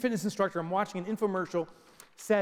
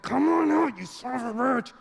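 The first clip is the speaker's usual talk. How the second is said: higher, louder effortful yell; straining yell; 6 semitones higher, 5 dB louder than usual. Acted. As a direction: strain and curse at the tube. Straining to squeeze tube.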